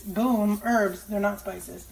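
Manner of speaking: ordinary delivery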